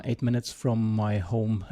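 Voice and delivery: usual speaking voice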